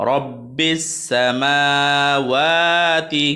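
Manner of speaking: each syllable of রব্বিস সামাওয়াতি drawn out very slowly; slow recitation. Shown as a demonstration. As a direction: stretch the phrase slowly like a careful recital. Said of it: "এতটুকু আমরা ভেঙে ভেঙে বললাম". (খুব ধীরে টেনে টেনে তিলাওয়াত)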